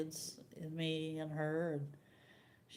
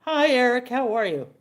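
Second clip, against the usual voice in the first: fake cheerful greeting; 6 semitones higher, 13 dB louder than usual. Daughter-in-law greets drinking son.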